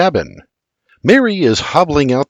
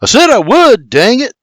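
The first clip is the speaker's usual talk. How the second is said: high, louder irritated retort; barked; 10 semitones higher, 5 dB louder than usual. A narrator's reading. William barks while stomping past the wagon, irritated. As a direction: snap back, fed up with nagging. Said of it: "William barks".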